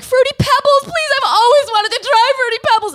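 put-on high, loud whiny kid begging; child pleading. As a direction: plead like a desperate little kid. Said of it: "And she's like".

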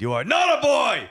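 very high, strained mock scream; over-the-top parental shriek, held high; hammed-up scream. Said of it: "I grabbed her and I screamed".

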